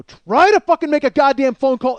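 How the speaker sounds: very loud, high yell; retold raging shout. Man daring her to make a phone call, raging shout. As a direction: re-enact losing it completely, shouting. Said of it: "I lost my fucking mind".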